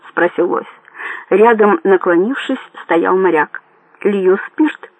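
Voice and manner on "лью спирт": usual speaking voice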